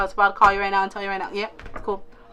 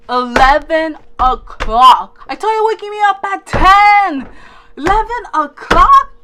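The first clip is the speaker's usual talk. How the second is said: loud, much higher disbelieving cry; panicked outburst; 6 semitones higher, 10 dB louder than usual. Retold or imitated. Realizing friends let her oversleep, loud panicked cry. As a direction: shriek the time in horror.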